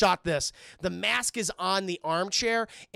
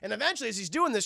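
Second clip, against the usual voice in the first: usual speaking voice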